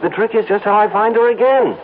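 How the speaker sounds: high, eager rush of words; bursting to share the news; excited. Acted. Ad man telling Harry he found the girl, excited and high.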